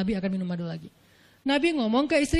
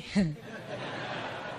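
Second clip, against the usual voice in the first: breathy laugh; 7 semitones lower, 11 dB quieter than usual. Real, not acted. Laugh after Aisyah's reaction.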